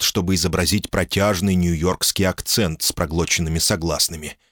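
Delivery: ordinary talk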